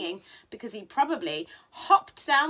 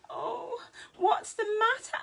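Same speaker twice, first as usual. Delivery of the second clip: high gasp then worried question; sudden worry at a crying fox; gasp of concern; 11 semitones higher than usual. Acted. George sees crying fox.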